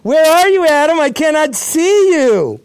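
loud high calling out, slowing at the end; mock calling out for Adam; God calling for Adam. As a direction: call out mock-searching, big and high. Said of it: "He's walking through the garden going".

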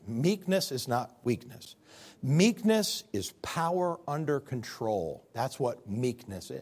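slow, deliberate teaching line; slow, weighty definition. Shown as a demonstration. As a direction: lay each word down slowly. Defining meekness slowly.

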